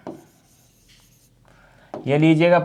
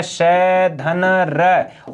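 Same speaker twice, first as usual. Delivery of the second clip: very slow spelling out; much slower than usual. Shown as a demonstration. Teacher spelling out word parts.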